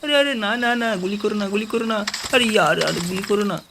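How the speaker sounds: mock pleading. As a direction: beg frantically not to be shot. Pet begging not to be shot. (পোষা প্রাণীর উঁচু গলায় ভয়ে অনুনয়, গুলি করো না)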